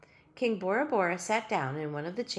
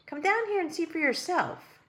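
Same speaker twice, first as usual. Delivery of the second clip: put-on girl's voice; 11 semitones higher, 3 dB louder than usual. Ellie orders the king.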